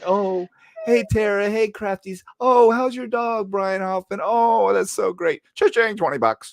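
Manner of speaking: high, syrupy fake-friendly voice; Dago. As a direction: gush sweetly, then cash in. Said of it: "when we were talking about Dago, how he baits in everybody".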